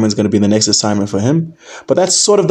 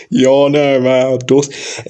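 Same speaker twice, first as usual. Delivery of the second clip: put-on London roadman accent; 2 semitones higher, much slower than usual. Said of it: "I'm so finished with this accent".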